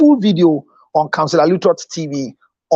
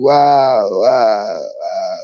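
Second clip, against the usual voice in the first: wow sinking lower each time; swooning wow; 12 semitones lower, much slower than usual. Pretend. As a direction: melt dramatically with each wow. Swooning at repeated compliment.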